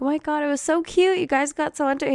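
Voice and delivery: high gushing coo; high, cooing and delighted. Retold or imitated. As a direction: coo it up high like you're charmed. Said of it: "I'm like".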